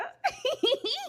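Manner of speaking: high-pitched little giggle; real giggle. Real, not acted. Woman with money to treat herself, giggling. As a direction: giggle at indulging yourself.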